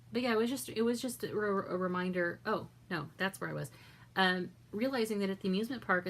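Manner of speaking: ordinary delivery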